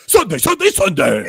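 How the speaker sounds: shouted cheesy announcer chant; monster truck ad. Retold or imitated. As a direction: hype it like a rally promo. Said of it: "it's so cheesy".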